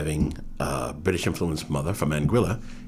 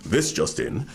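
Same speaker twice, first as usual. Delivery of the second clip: lightly voiced newscaster, pitched up; a hint of news-anchor delivery; newsreader brother; 6 semitones higher, slower than usual. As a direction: briefly imitate a brother's news-desk voice. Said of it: "my brother always sounds like he's going to tur-, do the news".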